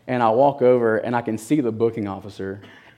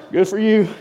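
higher, louder cheerful inmate; friendly inmate; 9 semitones higher, 6 dB louder than usual. Retold or imitated. Inmate congratulating his schoolmate, cheerful and louder. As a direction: give a buddy's approval.

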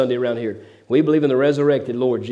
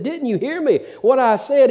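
much higher, a hammed-up shriek of a demand; mock frog-woman scream; 9 semitones higher than usual. Frog woman yelling at the old fisherman, mock high shriek. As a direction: squeal it like the frog lady losing patience. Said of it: "she screams out again".

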